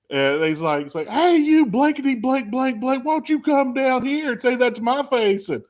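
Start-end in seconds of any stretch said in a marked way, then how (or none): 1.1-5.5 put-on high, rowdy drunk fan taunt; drunk heckler. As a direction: holler it like a drunk tailgater. Drunk fan yelling challenge.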